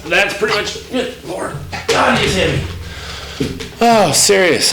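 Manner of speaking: straining under weight. Real, not acted. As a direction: groan it out hoisting a heavy dog.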